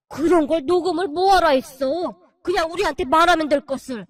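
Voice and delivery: loud, scolding rage; furious father-in-law. Acted. Father-in-law scolding his daughter-in-law, loud and furious. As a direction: scold her for going alone.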